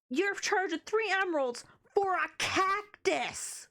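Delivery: outraged complaint, drawn out; you can't be serious, slowly; indignant. Real, not acted. Haggling with a villager.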